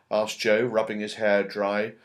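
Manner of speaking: ordinary delivery, nothing marked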